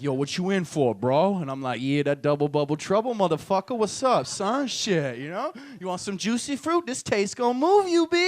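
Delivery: loud hammed-up jailhouse tough guy; brash prison yard swagger; tough inmate voice. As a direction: bark it like a cocky convict. Jail cellmates, gangster voice.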